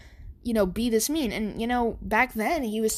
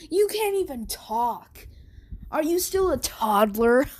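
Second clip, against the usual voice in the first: higher, mocking bully sneer; 4 semitones higher than usual. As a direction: talk down to him like a bratty bully. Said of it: "And Bakugo's like".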